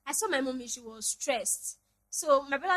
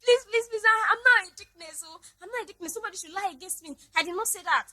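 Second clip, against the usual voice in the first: higher, tearful mother's pleading; imitating mom tearful plea; 7 semitones higher, 6 dB louder than usual. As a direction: beg like a mom near tears. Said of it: "That was how my mommy did".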